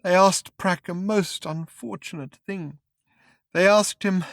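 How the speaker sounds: high, broken drugged man; frail, drugged voice; drugged witness. Acted. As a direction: stammer it out dazed and weepy. Drugged man's held voice.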